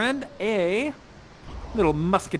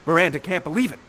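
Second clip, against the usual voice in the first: ordinary talk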